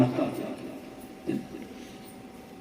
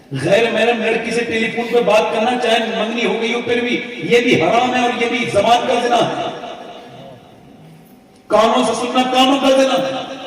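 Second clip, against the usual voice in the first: high, loud forceful sermon; fiery warning from the pulpit; preaching at full force; 8 semitones higher, 3 dB louder than usual. Real, not acted. Forceful moral warning to youth.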